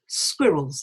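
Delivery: "Squirrels" stressed on SQUI, falling away; stress on first syllable. Shown as a demonstration. Teacher models squirrels, first syllable stressed. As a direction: hit the start of "Squirrels". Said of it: "the stress is on the squid".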